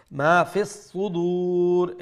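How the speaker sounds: slow, drawn-out chant; recitation. Shown as a demonstration. Teacher reciting the verse slowly for students. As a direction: stretch the words like a reciter. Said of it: "teacher recites verse".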